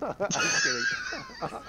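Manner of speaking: high laughing shout. Real, not acted. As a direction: burst out laughing while saying it. Laughing after joke.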